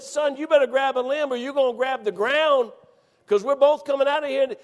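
high, loud, pressing warning; urgent warning. Retold or imitated. Hanging hunter warns buddy clinging to him, urgent holler. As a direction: holler the warning under strain.

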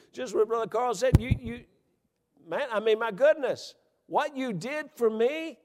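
high, loud preaching cry; preaching at full force. Acted. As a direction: lift it up high to the congregation.

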